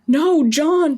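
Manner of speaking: grief-stricken sobbing shout; sobbed. Acted. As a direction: wail his friend's name through sobs. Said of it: "he sobbed".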